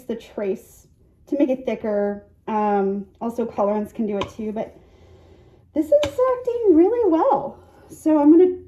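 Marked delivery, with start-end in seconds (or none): 5.7-8.6 excited. Real, not acted. Excited about the soap batter.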